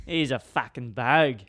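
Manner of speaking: slow, broad Australian bogan impression; imitated bogan accent. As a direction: draw out the bogan accent at full voice. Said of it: "how a bogan talks".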